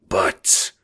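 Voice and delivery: controlled snarl of warning; a single cold, threatening word; cold-blooded snarl. A narrator's reading. Don turning on the informant, cold-blooded snarl. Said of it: "in a cold-blooded snarl".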